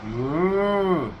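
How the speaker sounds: mooing like a cow; cow moo impression. Shown as a demonstration. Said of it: "in Russian".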